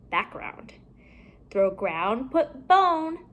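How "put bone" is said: usual speaking voice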